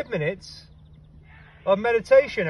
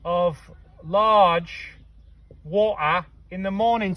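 one times glass of large water, slow and softer; slow dictation; 2 semitones lower, 3 dB quieter, slower than usual. Shown as a demonstration. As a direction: dictate it slowly like a recipe. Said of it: "So we'll say a glass of water".

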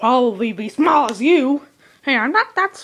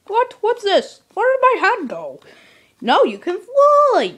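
shocked; 9 semitones higher, 3 dB louder than usual. Acted. Surprised at new wing.

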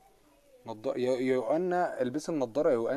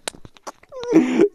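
high burst of laughter; 12 dB louder than usual. Real, not acted. Laughing after the rant.

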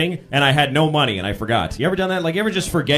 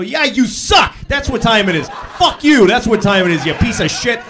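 loud, rude snap at a stranger; mock angry yell; 3 semitones higher, 4 dB louder than usual. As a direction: yell insults at the person asking the time. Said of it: "I just go".

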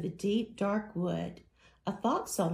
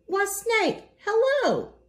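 very high, chirpy greeting; a squeaky little mouse calling hello sweetly; tiny cheery mouse; 11 semitones higher, 4 dB louder than usual. Acted. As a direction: mouse sings out a bright falsetto hello. Mouse greets snake brightly.

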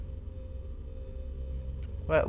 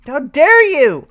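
outraged; 9 semitones higher, 8 dB louder than usual. Real, not acted. Repeated rising outrage.

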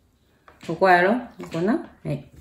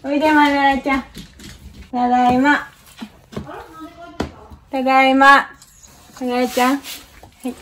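pet talk; 7 semitones higher than usual. Real, not acted. Greeting the pet at home.

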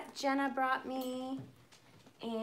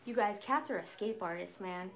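normal talking voice

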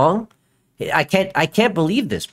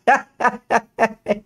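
staccato run of higher laughs; incredulous laughter; 5 semitones higher than usual. Real, not acted. Streamer reacting to a clip, incredulous laughter. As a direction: laugh in disbelief, in short bursts.

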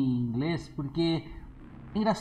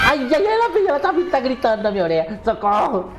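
high, loud, frantic yelling as she closes in; terrified shriek of a gamer being chased; panicked scream; 6 semitones higher, 4 dB louder than usual. Real, not acted. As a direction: scream for your life, words tumbling out fast.

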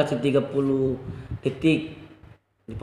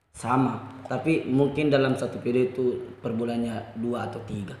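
ordinary talk

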